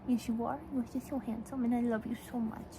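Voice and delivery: soft, doting aside to a dog; tender pet talk. Real, not acted. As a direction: murmur fondly to your dog.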